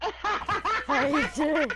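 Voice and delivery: slow, flirty, laughing pickup line. Pretend. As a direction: drag it out like a cheesy flirt. Flirting with game character.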